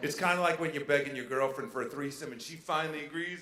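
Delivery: ordinary talk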